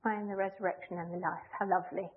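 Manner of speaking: a half-hearted shrug of a line; wishy-washy. Shown as a demonstration. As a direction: toss it off softly like it hardly matters. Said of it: "wishy-washy way".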